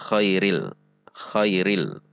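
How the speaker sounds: khairil with a voiceless KH scrape; kha for ghain. Shown as a demonstration. Warning learners about changing ghain, voiceless khairil. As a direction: open "khairil" on a raspy airflow, no voice. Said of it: "mengubah titik ghain".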